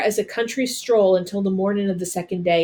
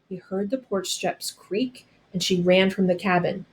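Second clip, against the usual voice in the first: plain reading voice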